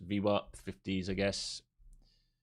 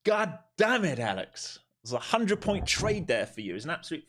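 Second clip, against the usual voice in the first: exasperated; 9 semitones higher than usual. Real, not acted. Exasperated at viewer's missed trade.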